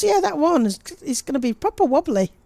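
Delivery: high, loud gleeful comment; excited. Real, not acted. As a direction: relish the wobbly stumps. Stumps bent by wicket.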